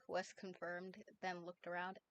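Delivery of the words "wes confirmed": ordinary delivery, nothing marked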